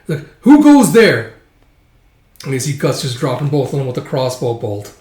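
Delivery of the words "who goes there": high, loud challenge from a guard; guard shouting. Acted. Guard on watch yelling at an intruder, loud and high. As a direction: bark a sentry's challenge up high.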